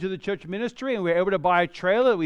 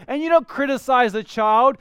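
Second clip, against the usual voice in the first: usual speaking voice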